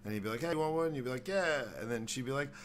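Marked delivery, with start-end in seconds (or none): 0.4-0.9 higher, louder friendly offer; friendly neighbor offer. Retold or imitated. Neighbor offering dabs to a guest, upbeat and higher. 1.3-1.6 eager yeah. Retold or imitated. Guest accepting dab.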